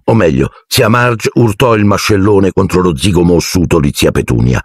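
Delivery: normal talking voice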